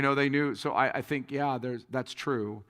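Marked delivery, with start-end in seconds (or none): none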